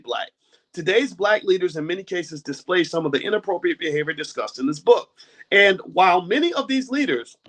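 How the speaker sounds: measured formal book reading; reading aloud. Acted. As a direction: read the passage out steadily. Reads book passage aloud.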